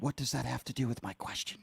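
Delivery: hushed, pointed question; whisper. Real, not acted. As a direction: whisper back at the caller. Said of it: "I'm gonna whisper in the microphone".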